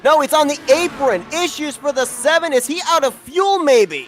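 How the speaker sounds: urgent live play-by-play; motorsport commentator. Real, not acted. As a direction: call the incident as it unfolds. Live race call of a wreck.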